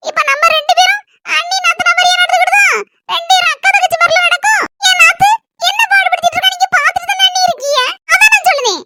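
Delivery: high-pitched complaining family figure; self-righteous sister-in-law. Acted. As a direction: argue your claim in a high voice. Plays the husband's sister. (உயர்ந்த குரலில் வாதாடும் நாத்தனார்)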